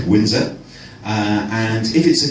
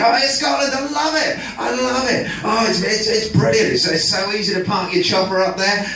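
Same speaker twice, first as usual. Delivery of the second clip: mock Prince Harry; 11 semitones higher than usual. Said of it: "And he was saying".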